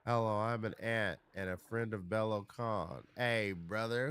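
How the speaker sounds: ordinary talk, nothing marked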